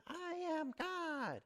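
drawn-out, high mock yell without much volume; Shirley MacLaine. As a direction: imitate a half-hearted yell to the ocean. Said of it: "And she yells to the ocean".

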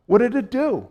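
ordinary talk

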